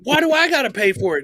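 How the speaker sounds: high, fast mock whine of unfairness; indignant protest. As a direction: argue back like a wronged kid. Retold kid arguing with dad.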